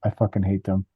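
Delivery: low and soft, a light impression of a grumpy father; grumbling dad. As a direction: drop the voice low and mutter the complaint. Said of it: "my dad was like".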